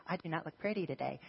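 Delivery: quick, put-on voice of a moody middle schooler; mock student whine. Preacher to congregation, mimicking a student's quick mock whine. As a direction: toss it off like a sulky kid.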